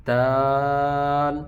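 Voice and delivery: دال drawn out for three counts; long daal. Shown as a demonstration. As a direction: hold دال long and even. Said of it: "تین حرکت تک لمبا کریں گے".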